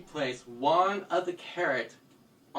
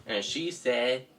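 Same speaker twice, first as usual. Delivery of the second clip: plain reading voice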